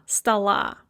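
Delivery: "stala" with first vowel ah, stress on the end; Russian "stala", unstressed o turned to ah. Shown as a demonstration. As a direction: say "stala" like sta-LA. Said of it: "it is pronounced as an a".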